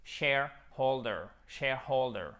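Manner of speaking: "shareholder" with the H of holder clearly sounded; don't drop the H in "shareholder"; H prononcé. Shown as a demonstration. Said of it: "On n'oublie pas le H". (« shareholder » avec le H de holder bien prononcé)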